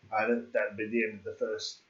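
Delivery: ordinary talk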